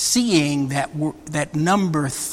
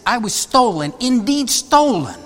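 higher and insistent, pressing hard on stolen, indeed stolen; very emphatic; 6 semitones higher than usual. Retold or imitated. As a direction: insist on it, landing each stolen with a firm fall. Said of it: "And then he's very emphatic".

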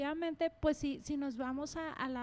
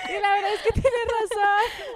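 laughing aloud; 8 semitones higher, 12 dB louder than usual. Real, not acted. Laughing after husband's quip.

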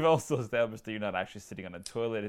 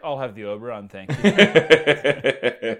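laughing through the order, rising louder at the end; 3 semitones higher, 15 dB louder, much slower than usual. Retold or imitated. Retelling his own order to the cohost, laughing loudly. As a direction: order it while cracking up.

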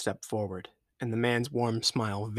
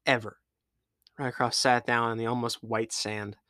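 ordinary delivery, nothing marked

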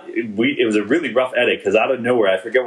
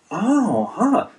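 mild surprise; 10 semitones higher than usual. Retold or imitated. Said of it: "a little bit of, like, a".

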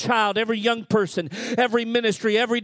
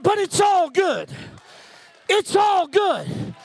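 preaching climax; 7 semitones higher, 7 dB louder than usual. Acted. Pastor rallying the congregation with its all good, shouting. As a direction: hammer the refrain home at full force. Closing sermon refrain.

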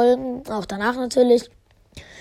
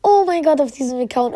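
ordinary talk

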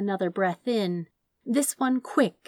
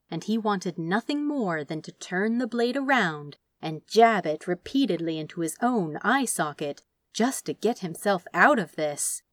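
ordinary delivery, nothing marked

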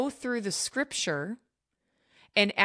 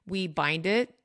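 ordinary talk, nothing marked

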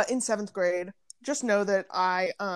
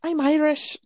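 higher-pitched imitation Irish accent; 6 semitones higher, 4 dB louder than usual. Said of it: "she goes like".

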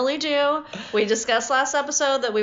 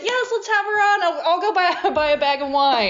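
eager, higher voice; excitedly imitating him; 8 semitones higher than usual. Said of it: "You were so excited".

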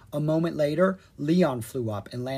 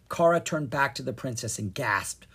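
plain reading voice

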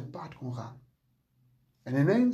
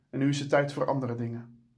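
ordinary talk, nothing marked

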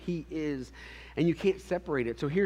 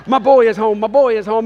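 much louder, higher, gleeful chant; ecstatic dad; 8 semitones higher, 16 dB louder than usual. Retold or imitated. Dad posting about his son, bellowing with joy. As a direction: shout it out like a proud dad showing off. Father with selfie stick, overjoyed.

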